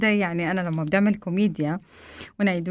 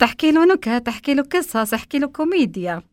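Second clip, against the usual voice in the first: higher, louder eureka list; excited idea; 5 semitones higher, 4 dB louder than usual. Retold or imitated. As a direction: announce each idea with delight. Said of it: "قمت قلت".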